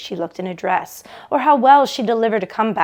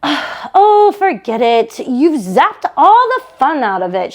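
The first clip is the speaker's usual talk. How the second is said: higher, louder, fed-up sister; Barbara exasperated; 3 semitones higher, 6 dB louder, slower than usual. Acted. Barbara giving up on her doubting sister, exasperated. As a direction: toss it off and hang up.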